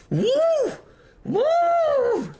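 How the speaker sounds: very high falsetto whoop; frustrated "woo". Acted. Chemist worked up over the wrong units, frustrated squeal. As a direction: squeal out a long, exasperated woo. Wordless outburst during the rant.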